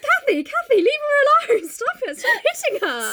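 very high, panicky mock protest; startled. Edgar seeing Kathy attack Nellie, mock startled. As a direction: plead in a hammed-up startled voice. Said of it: "Edgar's a little startled".

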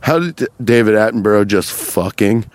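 usual speaking voice

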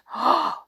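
quick high delighted gasp; a bright little intake of breath; excited gasp. Acted. Donald brightened.

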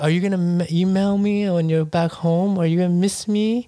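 put-on pleading, voice lifted and rising at the end; creepy guy imitation. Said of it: "He's like".

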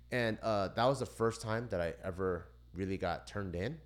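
ordinary talk

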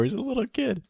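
normal talking voice

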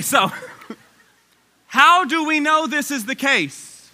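ordinary delivery

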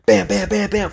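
high, punchy bam bam bam; rope slam noises. Retold or imitated. Runner mimicking battle ropes with high bams. Imitating partner's rope slams.

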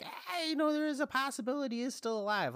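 high-pitched, squeaky pleading for a theory; hopeful protest. Retold or imitated. Fan floating a theory to doubters, high hopeful squeak. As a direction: pitch it way up, coaxing.

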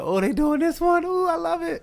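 very high, giddy squeal; excited high-pitched glee; delighted child viewer. Retold or imitated. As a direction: gush with delight at the TV. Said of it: "I'm like".

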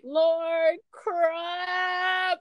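excited disbelief. Retold or imitated. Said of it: "I was like".

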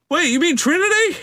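high, far-off squeaky voice; piping up from the back of the room; background guy voice. Acted. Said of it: "some guy chimes in from the background".

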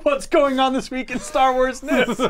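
real laughter breaking into the line; laughing through it. Real, not acted. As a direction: try to ask it while cracking up.

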